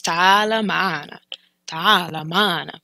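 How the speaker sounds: "Taala ma'ana" spoken slowly and clearly; slow Arabic model. Shown as a demonstration. Teacher modelling the phrase slowly for learners. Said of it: "teacher repeats phrase slowly".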